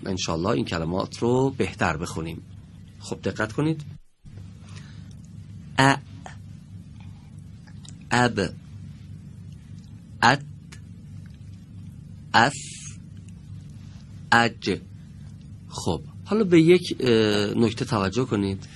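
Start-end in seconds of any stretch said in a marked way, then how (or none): 5.8-14.8 each letter with a short a before it, sounded clearly; letter drill. Shown as a demonstration. Teacher recites letters for students to follow.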